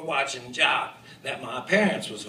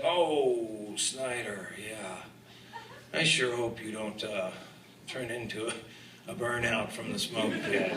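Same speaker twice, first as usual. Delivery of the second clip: sorrowful coach; 7 semitones lower, 8 dB quieter than usual. Retold or imitated. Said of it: "looked at me with all this sorrow".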